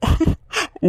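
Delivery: short amused laugh, high; light laugh, affectionate disbelief. Acted. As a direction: chuckle fondly at her. Husband laughs at wife's worry.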